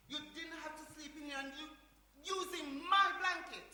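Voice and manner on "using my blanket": loud, high stress on MY; outraged emphasis; indignant scolding. Acted. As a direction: complain loudly and slowly, possessive. Acted scolding of brother.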